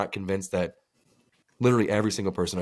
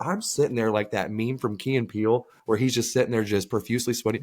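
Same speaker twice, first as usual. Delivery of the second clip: ordinary delivery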